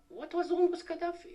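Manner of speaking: mock incredulous. Voicing the Middle Eastern view.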